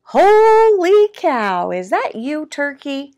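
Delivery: loud, high, stretched-out exclamation; storybook pig in amazement; astonished pig. A narrator's reading. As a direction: exclaim it high, dragging the first words. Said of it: "snorted Pig".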